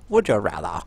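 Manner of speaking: low, hammed-up classy accent. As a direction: put on a classy, posh accent for RATHER. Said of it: "let's just classy it up a bit".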